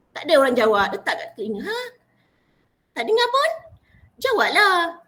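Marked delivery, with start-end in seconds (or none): 1.6-5.1 high, loud prodding of the audience; demanding a reply. Acted. Preacher to audience who left the greeting unanswered, loudly prodding. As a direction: call out for an answer, pitched up.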